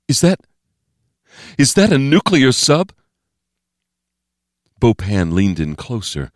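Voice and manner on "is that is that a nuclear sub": high, halting question rising at the end; stunned disbelief. A narrator's reading. Steve realizing it is a submarine, high stunned voice. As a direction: stumble into the question, pitch way up.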